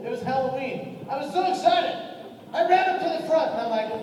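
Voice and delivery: ordinary talk, nothing marked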